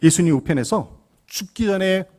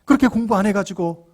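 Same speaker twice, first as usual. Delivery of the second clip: high, loud scolding; angry mother; 6 semitones higher, 5 dB louder than usual. Retold or imitated. Mother telling off her son for not studying, angrily. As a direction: scold your child in a raised, indignant voice. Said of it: "화를 내는 거예요".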